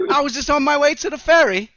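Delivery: high, loud camp English voice; English accent. Acted. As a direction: chirp it like a camp Brit. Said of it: "we got an English homosexual over here".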